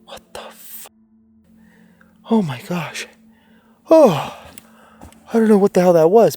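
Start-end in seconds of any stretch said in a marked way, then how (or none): 0.0-0.9 shaken whisper. Real, not acted. Shaken after the scare. 3.9-6.3 startled outburst. Real, not acted. Reacting to the scare.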